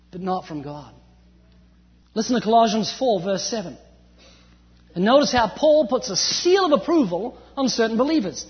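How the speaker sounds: normal talking voice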